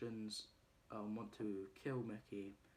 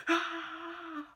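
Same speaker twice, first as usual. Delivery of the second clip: a sudden, much higher yelled "Ah!"; imitated scream; 10 dB louder than usual. [name] watching the horrific video, high mock scream. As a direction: shriek it out in mock horror.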